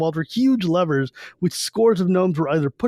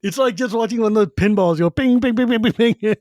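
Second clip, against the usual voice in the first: pinball pings; 5 semitones higher than usual. Retold or imitated. Pinball bounce imitation.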